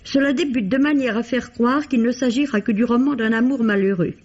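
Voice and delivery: ordinary talk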